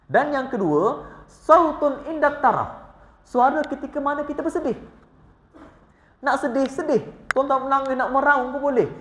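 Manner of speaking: high, driving preacher's voice; fervent preaching. Acted. Preacher urging the congregation to weep freely, fervent. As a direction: preach it out to the hall.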